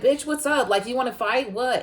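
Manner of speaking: picking a fight, in her face; daring her to fight. Retold or imitated. To the bully who kept talking, a loud taunting dare.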